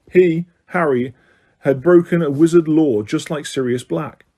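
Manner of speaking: ordinary delivery, nothing marked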